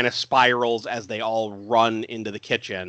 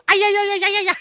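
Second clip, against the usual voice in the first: very high, loud, rapid repeated yelps; high mock whooping; 5 dB louder, much faster than usual. Host imitating the girl flashing her top, shrill whooping. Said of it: "her top and goes".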